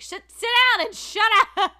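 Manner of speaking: put-on loud, high barked order at kids; shouting down a rowdy class; mock teacher yell. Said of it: "Be like".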